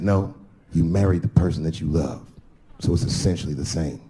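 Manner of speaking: low-pitched correcting friend voice; voiced thug friend. Friend correcting the comedian on marriage, low imitated voice.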